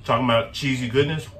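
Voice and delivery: talking through a mouthful of food; mouth full. Real, not acted. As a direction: speak with your mouth full. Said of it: "Talking with my mouth full".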